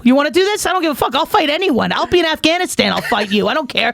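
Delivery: high, hammed-up fight-me taunt; mock tough-girl challenge. To the souk guys, a high mock fight-me taunt. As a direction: pitch it up like picking a street fight. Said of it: "I'm like".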